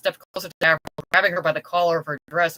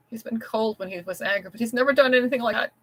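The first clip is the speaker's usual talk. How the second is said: tearful; 6 semitones higher than usual. Acted. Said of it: "burst into tears".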